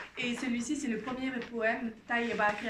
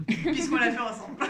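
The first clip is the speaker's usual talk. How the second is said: louder, quick, laughing aside; amused, breaking into laughter; 6 dB louder than usual. Real, not acted. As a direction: say it through a real laugh. Joking about her translation.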